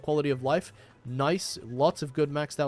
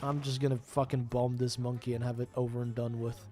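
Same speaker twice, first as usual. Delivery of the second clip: normal talking voice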